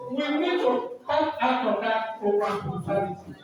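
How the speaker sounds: normal talking voice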